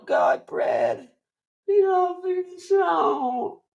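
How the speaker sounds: very high, drawn-out mock crying; hammed-up wailing, squeaking into sobs; Leo. Actor begging his stunt double about his career, exaggerated blubbering. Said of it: "that's kind of his thing for the movie".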